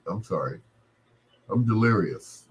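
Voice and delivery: usual speaking voice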